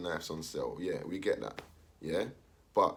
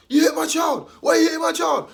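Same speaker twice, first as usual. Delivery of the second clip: much higher, much louder outraged shout; angry mum shouting; 14 dB louder than usual. Retold or imitated. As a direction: storm in defending your child. Mum confronting the teacher.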